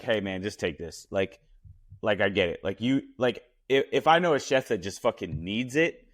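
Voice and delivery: normal talking voice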